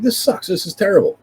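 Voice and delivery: high mock whine; disgusted complaint. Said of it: "So I'm like".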